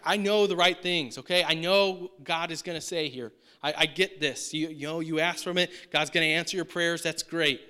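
loud, impatient assurance; lightly acted 'yes, I get it'; brisk know-it-all doer. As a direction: insist you already know. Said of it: "She's a doer".

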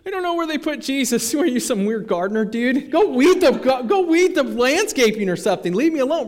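high, loud, exasperated dismissal; mock brush-off. Mary brushing off a supposed gardener, mock exasperated. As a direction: shoo off a pest, pitch way up. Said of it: "you're turning around saying".

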